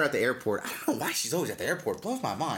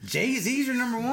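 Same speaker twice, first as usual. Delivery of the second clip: can't believe what he just heard; incredulous; 12 semitones higher than usual. Real, not acted. Questioning a friend's top rapper, in high-pitched disbelief. Friend ranks [name] first.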